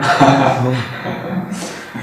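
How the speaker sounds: warm, happy laugh; laughing with relief and gratitude. Real, not acted. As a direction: devotee laughing after thanking the author. Grateful laugh after thanks.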